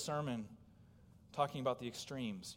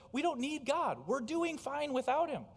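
raised and louder, dismissive; smug scoffer; 11 semitones higher, 4 dB louder than usual. Retold or imitated. Preacher voicing people who say they need no God, dismissive. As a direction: wave God away, self-satisfied. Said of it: "people have just said".

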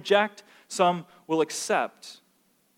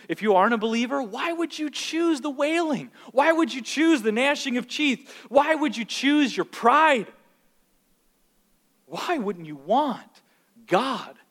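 high, pleading preacher's questions; impassioned preaching appeal; 7 semitones higher, slower than usual. Real, not acted. Preacher to unbelievers in congregation, urgent pleading. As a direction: plead urgently, stretching the key words.

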